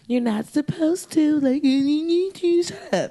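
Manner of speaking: put-on high, slow whiny kid; annoying kid. Bratty kid telling someone to shut up, high and slow. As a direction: whine like a bratty classmate. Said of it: "annoying kids that I went to school with".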